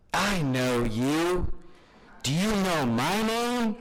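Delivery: a fond impression of grandpa, slow and high; grandpa's greeting. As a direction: play the teasing old grandpa, stretching each word. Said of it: "And he'd be like".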